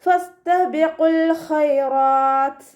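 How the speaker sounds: slow, drawn-out melodic tajweed chant; Quran recitation. Shown as a demonstration. Teacher models melodic Quran recitation for students. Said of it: "അതുപോലെ ഓതി കൊടുക്കുക".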